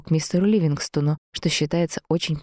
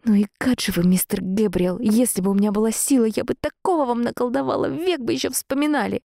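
indignant rant climbing higher and higher; grumbled cursing; 6 semitones higher than usual. A narrator's reading. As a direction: curse him to yourself, pitch rising. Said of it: "вслух тихо ругаюсь".

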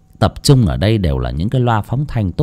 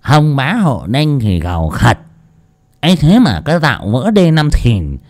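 put-on drunk freeloader; 6 semitones higher than usual. A narrator's reading. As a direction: wave him off and lecture. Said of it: "đoạn xua tay bảo".

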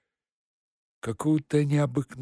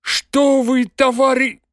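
plain reading voice

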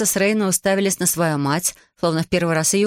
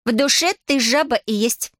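scornful insult; 6 semitones higher than usual. A narrator's reading. Witch insults boy as toad.

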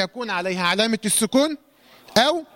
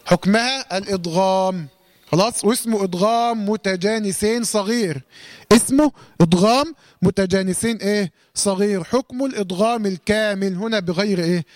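ordinary talk

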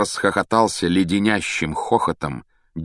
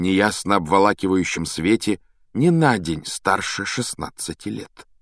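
plain reading voice

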